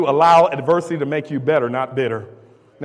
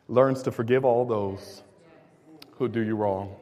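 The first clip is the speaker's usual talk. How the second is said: usual speaking voice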